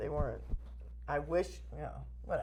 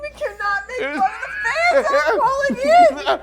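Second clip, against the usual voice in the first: much higher and louder, laughing through a shout; crying with laughter while scolding; shrieking laughter; 13 semitones higher, 8 dB louder than usual. Real, not acted. Scolding her co-host for mocking a fan, shrieking with laughter.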